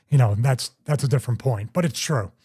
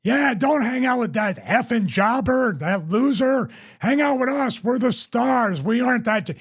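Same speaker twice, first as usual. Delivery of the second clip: mocking taunt; 7 semitones higher than usual. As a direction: needle him in front of the whole bar. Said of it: "and he's like".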